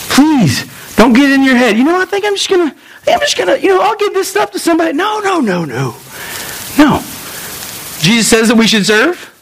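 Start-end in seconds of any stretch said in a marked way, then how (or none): 1.8-4.9 high whiny put-on voice climbing into a shout; hammed-up inner voice giving up; mock quitter's inner voice. 4.9-7.0 emphatic no. Real, not acted. Preacher rejecting the excuse.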